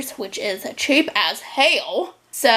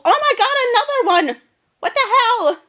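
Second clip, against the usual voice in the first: startled shriek; 12 semitones higher than usual. Real, not acted. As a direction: yelp in shock at a spider. Finds another spider.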